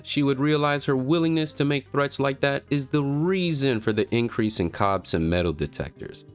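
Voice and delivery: usual speaking voice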